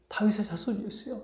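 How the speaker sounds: soft, high imitated cry; understated mock wail. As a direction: call out pleadingly under your breath. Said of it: "외치고 울부짖었을 때".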